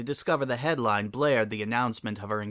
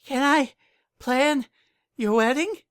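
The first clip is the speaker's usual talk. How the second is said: out of breath; 4 dB louder, slower than usual. Acted. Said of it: "she panted".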